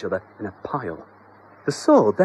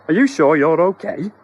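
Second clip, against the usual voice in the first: higher, anxious husband voice; high worried homeowner voice; 5 semitones higher, 6 dB louder than usual. Acted. Husband asks the flattened exterminator, higher and anxious. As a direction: raise the pitch, fret gently. Mister Doherty voiced in story.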